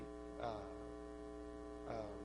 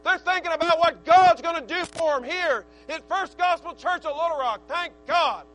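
loud, raised, driving sermon voice; full-force preaching; 4 semitones higher, 7 dB louder than usual. Real, not acted. Preacher rousing the church about young people, full-force preaching. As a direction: preach it hard, climbing to a high, loud peak.

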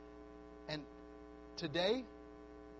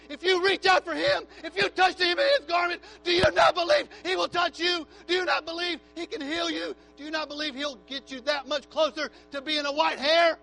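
full-force altar call, louder and higher; preach; 7 semitones higher, 10 dB louder than usual. Acted. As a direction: rouse the congregation to come forward.

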